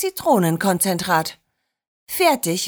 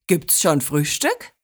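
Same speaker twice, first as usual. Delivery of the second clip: lower-pitched jovial question; cheerful grandpa; 6 semitones lower than usual. A narrator's reading. Grandpa asks after breakfast, cheerfully and low. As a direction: ask merrily in a low grandpa voice. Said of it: "rief er fröhlich".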